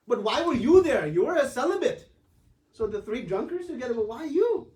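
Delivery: hammed-up teasing question; teasing jab. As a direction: poke fun at him with mock surprise. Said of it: "And he starts laughing".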